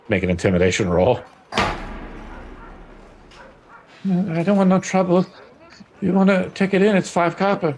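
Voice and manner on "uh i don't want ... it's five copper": high, jittery small-time guard; nervous doorkeeper. Acted. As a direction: squeak it out, eager to avoid trouble. GM voices nervous doorman.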